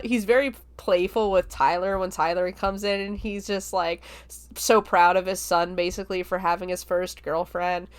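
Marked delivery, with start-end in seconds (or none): none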